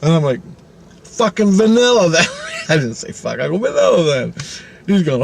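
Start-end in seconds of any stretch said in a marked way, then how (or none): 1.0-2.3 high, loud mock exasperation; retold fed-up customer; exasperated reply. 3.6-4.3 high-pitched, mildly fed-up concession; retold customer settling for less; exasperated reply. Redoes exasperated reply without swearing.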